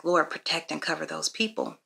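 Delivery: hushed, quick-thought prayer; quiet, understated plea to God; praying. Retold or imitated. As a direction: pray softly under your breath. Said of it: "so I start praying".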